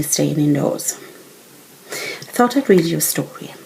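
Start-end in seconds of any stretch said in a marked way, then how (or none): none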